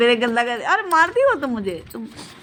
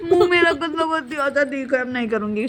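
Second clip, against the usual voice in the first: ordinary talk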